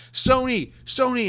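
loud high holler, calling out a name; mock yell. Phil Spencer calls out Sony at E3, mock yell. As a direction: imitate an executive yelling from the stage. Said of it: "he yells really loud".

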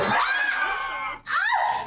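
real high burst of laughter; big laugh. Real, not acted. Host cracking up at co-hosts' antics, high shrieking laugh.

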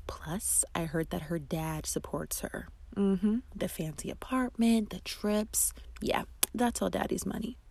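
hushed, conspiratorial whisper; whispered gossip. Acted. Friend leaning in to gossip at brunch, whispering. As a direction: lean in and whisper it like a secret. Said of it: "and whispers".

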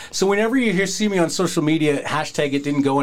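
ordinary delivery

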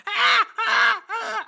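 high, loud shrieking animal cries; imitated jungle animal screams. Mocking an ASMR jungle track with loud high animal shrieks. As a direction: screech like a jungle creature. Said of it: "it's like".

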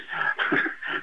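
short breathy nervous laugh; embarrassed unvoiced chuckle. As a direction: laugh it off awkwardly. Nervous caller confessing to investigation.

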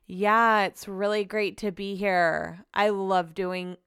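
slow, lifeless flat delivery; mock-bored guest, slow and dull; monotone impression. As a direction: drain every bit of inflection out. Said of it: "that girl is monotone".

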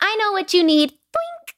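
high-pitched fairy voice with a squeaky little boink; Tinkerbell fairy. Retold or imitated. Joking her friend is a musical fairy, high fairy voice. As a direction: play a fairy up high, ending on a tiny boink. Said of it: "She's like my Tinkerbell".

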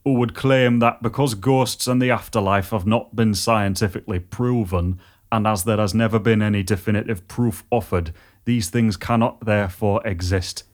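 put-on northeast England accent with a bit of Yorkshire; Redcar accent. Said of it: "I'll give it a go".

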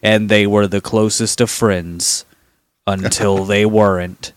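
mock-ominous narrator, slowing and sinking; true-crime YouTuber. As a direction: parody the foreboding episode opener. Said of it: "not be this guy every single episode".

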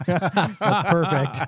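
slow, high laugh through the words. Real, not acted. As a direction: let the laugh break the line. Laughs at his own joke.

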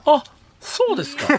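cracking up high in surprise. Real, not acted. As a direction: voice flips up into falsetto. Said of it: "今声が裏返ってましたよ".

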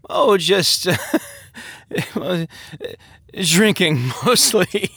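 put-on high, giggly drunken confession; slurred drunk. A narrator's reading. Drunk man admits what he has been doing, giggling. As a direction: break it up with tipsy laughter. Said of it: "he slurred".

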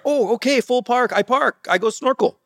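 high-pitched, broken-English fake foreigner; feigned non-English speaker. Driver pretending no English to guard, high fake foreign voice. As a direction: agree cheerfully in pidgin as a clueless foreigner. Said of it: "pretend that I didn't speak English".

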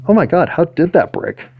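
high, baffled disbelief; can't believe it broke; astonished. Real, not acted. Repeats, more astonished.

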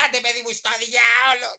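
acted shout. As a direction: yell it like an overacted series scene. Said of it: "έχεις δει που φωνάζουν κάτι". (παιχτή φωνή, σαν σε σειρά)

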